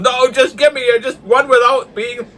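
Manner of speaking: high, loud retold protest; imitated impatient yelling. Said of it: "And my brother's yelling".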